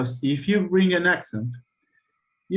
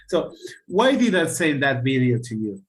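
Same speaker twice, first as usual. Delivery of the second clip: normal talking voice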